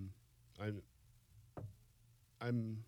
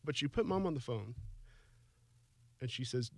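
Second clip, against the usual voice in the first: choked up, higher-pitched with long pauses; tearful; 5 semitones higher than usual. Real, not acted. Son recalling his mother's words on the phone, tearful. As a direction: fight back tears through halting phrases. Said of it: "until I cried".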